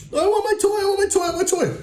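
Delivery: put-on very high, whiny wailing child voice; crying toddler. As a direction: wail it like a toddler who lost her toy. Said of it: "she starts crying".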